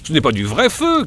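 high, eager call; excited cry. A narrator's reading. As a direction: call out the discovery. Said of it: "crie Henri".

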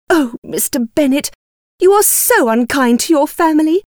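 high, complaining wife; reproachful. A narrator's reading. Wife scolding her teasing husband, reproachful.

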